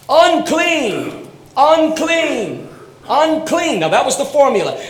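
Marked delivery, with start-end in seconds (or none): 0.1-3.8 leper's cry. Retold or imitated. Leper crying out warning.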